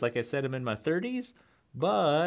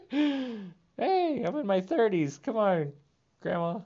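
high, loud laughing squeal of protest; 12 semitones higher, 4 dB louder, slower than usual. Retold or imitated. Protesting to grandma's ghost, laughing and squealing. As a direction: laugh it off, begging grandma to ease up. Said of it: "I'm like".

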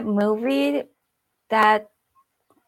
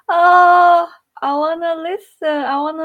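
higher and louder, bursting with eagerness; excited rush; eager; 8 semitones higher, 10 dB louder than usual. Real, not acted. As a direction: jump in keen to hear it. Eager to hear a viewer's accent.

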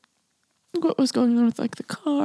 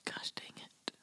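much softer, swallowed by tears; a real tearful curse under her breath; choked up; 19 dB quieter than usual. Real, not acted. Cursing softly at her own tears while telling the story.

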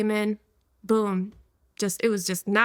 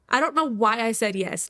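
ordinary talk

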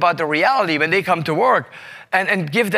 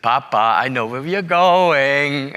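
drawn-out, lower singsong tease, imitating a daughter; teasing child; 4 semitones lower, slower than usual. Daughter teasing papa in the taxi, drawn-out mock-child teasing. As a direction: stretch it out like a teasing kid.

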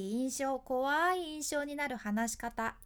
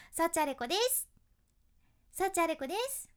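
ending raised too high, cutesy; idol self-introduction; 4 semitones higher than usual. Shown as a demonstration. Teacher demos an idol-style rising ending. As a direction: lift the end of 幸あれ子です up like a pop idol. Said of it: "アイドルの自己紹介になってしまいます".

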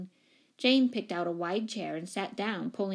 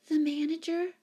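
very high, squeaky question; startled squeak; 9 semitones higher than usual. A narrator's reading. Flustered tenant asked for the manager, squeaking. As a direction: squeak it out in alarm. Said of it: "squeaked Miss Giddings".

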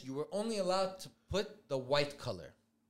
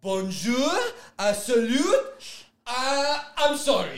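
loud, higher mock-French hesitation; imitated French greeting; 7 semitones higher, 9 dB louder, slower than usual. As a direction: stall awkwardly, acting out a halting foreigner. French streamer quote, acted out.

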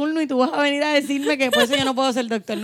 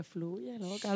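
hushed wink; 16 dB quieter, much slower than usual. Retold or imitated. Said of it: "como que".

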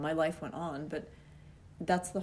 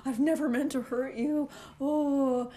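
mock teary apology; 5 semitones higher than usual. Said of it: "starts tearing up".

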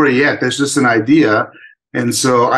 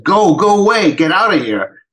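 higher-pitched mock shout of dismissal; mild retold yell; 6 semitones higher than usual. Girl sending her tiger back to the forest, mildly imitated yell. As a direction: imitate yelling to drive someone off, without full volume. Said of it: "she yells at him".

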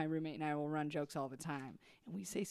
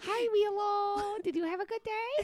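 doting dog-talk voice; 6 semitones higher than usual. Shown as a demonstration. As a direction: coo over a beloved dog, starting very high. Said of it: "To her".

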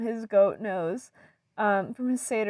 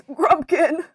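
laughing character voice; 7 semitones higher, 10 dB louder than usual. Acted. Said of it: "Blasmith goes".

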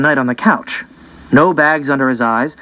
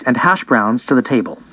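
usual speaking voice

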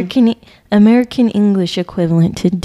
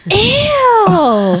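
high, drawn-out grossed-out ew; disgusted ew; 11 semitones higher than usual. Retold or imitated. Retelling the girls' reaction, disgusted squeal.

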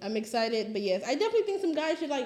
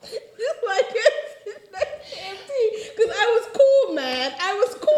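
laughing; 8 semitones higher, 6 dB louder, slower than usual. Real, not acted. Laughing fit with crying sounds.

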